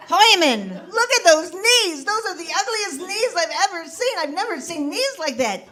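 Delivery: put-on high, squawking Yiddish accent wife; a kvetching Yiddish bride. Esther voiced, high.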